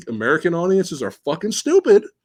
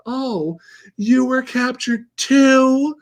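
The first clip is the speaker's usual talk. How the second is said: put-on girl voice; 8 semitones higher, slower than usual. Retelling two captive girls meeting.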